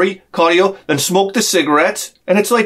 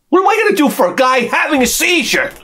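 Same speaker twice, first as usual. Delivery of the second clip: exasperated shout; 7 semitones higher than usual. Acted. Comedian defending stepping over a seizing man, shouting. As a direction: throw it at the crowd, fed up.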